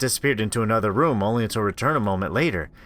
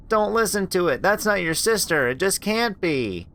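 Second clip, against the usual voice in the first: higher, firm, slowing at the end; urgent warning; 8 semitones higher than usual. Acted. Anita warning Rachel the voice isn't her sister, urgent. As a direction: insist it isn't really her.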